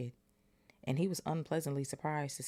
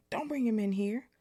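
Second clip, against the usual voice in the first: higher, louder and urgent; hurried; 9 semitones higher, 5 dB louder than usual. Acted. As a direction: rush it out in alarm. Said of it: "said Ron hurriedly".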